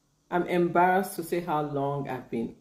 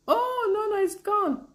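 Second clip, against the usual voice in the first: playful dismay; 4 dB louder than usual. Real, not acted. Learner being tested on her Arabic, playful dismay. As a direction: wail lightly at being put on the spot. Put on the spot by Arabic test.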